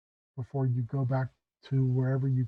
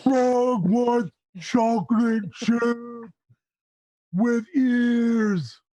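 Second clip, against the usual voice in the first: much higher, louder, slow ogre shout; raging ogre; 10 semitones higher, 5 dB louder, much slower than usual. Acted. Ogre raging in the street for ice cream, slow bellow.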